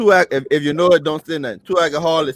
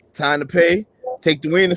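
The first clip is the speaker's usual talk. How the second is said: ordinary talk, nothing marked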